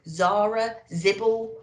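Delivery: ordinary delivery, nothing marked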